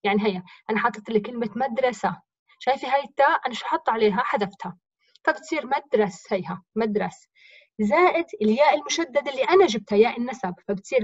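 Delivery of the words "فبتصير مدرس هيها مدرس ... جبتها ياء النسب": ordinary talk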